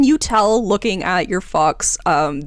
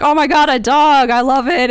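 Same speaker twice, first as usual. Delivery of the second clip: high and louder, squealing over a dog; gushing; 7 semitones higher, 5 dB louder than usual. Acted. Patrons gushing over the fox, excited and high. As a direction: gush like a dog lover.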